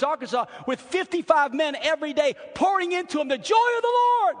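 high, loud preaching that builds to a shout; fervent pulpit crescendo; impassioned preaching. Real, not acted. Preaches at full force.

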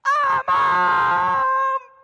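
high, loud mock child's cry for mom; imitated child crying out. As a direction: shout for mom like a hurt child. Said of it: "child cry out".

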